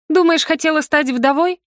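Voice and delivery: high-pitched, heated retort; flaring indignation. A narrator's reading. Heroine to accusing husband, indignant outburst. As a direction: flare up with indignation. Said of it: "я вспыхнула от негодования".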